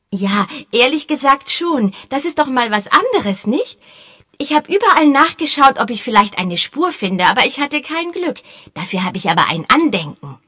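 put-on high, loud, quick boyish voice; eager boy. A narrator's reading. Boy Peter pestering Sir Henry, high eager voice.

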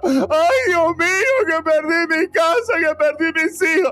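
mock lament. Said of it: "Satanás esperaba que Job dijera".

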